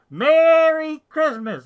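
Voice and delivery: very loud, high, drawn-out shout; demonstrative holler. Shown as a demonstration. Proving a mask doesn't stop holiday wishes, huge drawn-out holler. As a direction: bellow it, stretching MERRY out long and high. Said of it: "You can still say it".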